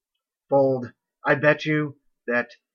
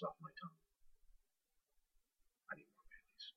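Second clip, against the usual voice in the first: whisper; 31 dB quieter, much faster than usual. Real, not acted. Host's tipsy aside about coffee.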